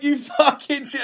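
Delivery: high, giggling mock outrage; squealing with laughter; laughing insult. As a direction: laugh it out at him. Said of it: "I was like".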